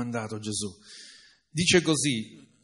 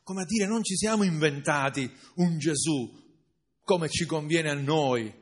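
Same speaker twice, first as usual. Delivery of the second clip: usual speaking voice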